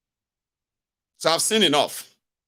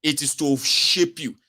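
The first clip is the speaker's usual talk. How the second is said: usual speaking voice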